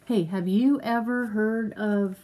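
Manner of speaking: usual speaking voice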